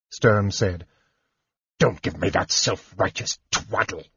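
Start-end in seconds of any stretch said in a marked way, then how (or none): 1.8-4.0 a softer, contemptuous snarl; mild, hushed snarl of contempt. A narrator's reading. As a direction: bite it out with scorn. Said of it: "Derek snarled".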